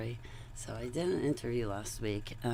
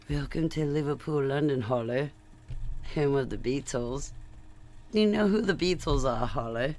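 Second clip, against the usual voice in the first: replaying the British greeting in a loose British accent, louder; a hammed-up British accent, pitched up; practiced British accent; 3 semitones higher, 5 dB louder than usual. Practicing the British host's welcome, in a loose British accent. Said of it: "practice my London accent".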